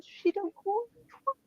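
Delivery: high mock voice of the stepdad. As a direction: parrot his denial back at him. Mimicking stepdad's denial.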